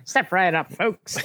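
lightly voiced carnival barker; a hint of a barker calling the crowd. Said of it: "his three favorite words are".

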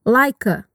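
"Like a." run together as ly-ka; linked like a. Shown as a demonstration. Teacher showing how like links to a. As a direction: glide "like" straight into "a". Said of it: "juntar o som de like com o som de a".

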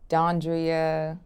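"Dondria" said DAHN-dree-uh; mispronounced name. Shown as a demonstration. Interviewee lists what people wrongly call her, mispronounced name. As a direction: drop the first ee, saying "Dondria" as DAHN-dree-uh. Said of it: "Do people mispronounce this?".

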